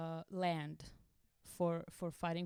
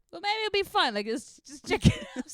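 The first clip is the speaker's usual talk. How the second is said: higher, louder, tempted inner voice; reckless inner voice; 10 semitones higher, 9 dB louder than usual. Retold or imitated. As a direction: chirp it like the naughty voice in your head. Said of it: "there's another voice that's like".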